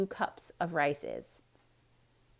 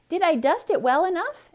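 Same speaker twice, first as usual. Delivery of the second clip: higher, louder innocent question; lightly voiced eager Amelia; 10 semitones higher, 8 dB louder than usual. A narrator's reading. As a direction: ask hopefully. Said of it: "asked Amelia Bedelia".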